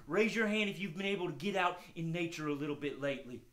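ordinary delivery

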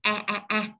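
"A, a, a" as short flat-E vowels, mouth slightly stretched; three short open "a" sounds, between A and E. Shown as a demonstration. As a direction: stretch the lips slightly on each "a". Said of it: "Hơi hai khóe miệng hơi căng".

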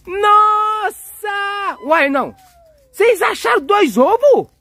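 high, loud playful amazement; astonished. Pretend. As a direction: gasp it out high, stretching the exclamation. Surprise at finding two eggs. (espanto exagerado, voz aguda)

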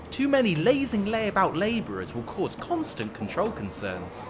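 ordinary delivery

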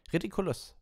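high-pitched called spell. A narrator's reading. Dean casts at the severed hand, calling out. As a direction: cast it with a high cry. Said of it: "rief Dean".

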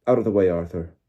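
loud, cold command; cold and curt. A narrator's reading. Official pushing past the father, cold and curt. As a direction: order him aside curtly. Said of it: "said a cold, curt voice".

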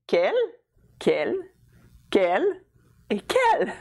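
"quel" repeated identically, pause after each; four identical takes of "quel", spaced out; pronunciation demo. Shown as a demonstration. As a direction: say each "quel" with the same sound. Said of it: "they're all pronounced the same".

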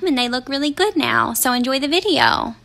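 normal talking voice

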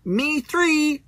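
timid dinosaur voice. Acted. Toy dinosaur chiming in with friends, high timid voice. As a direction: squeak it out like a nervous toy dinosaur.

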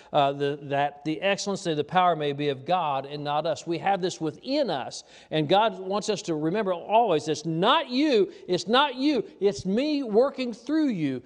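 high, loud preacher's cadence; loud revival preaching, slowing on the key words; full-force preaching. Acted. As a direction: thunder it out to the congregation.

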